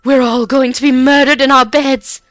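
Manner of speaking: loud high quavering wail. A narrator's reading. Aunt quavers in terror to the huddled girls. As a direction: let the fear wobble through. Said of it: "she quavered".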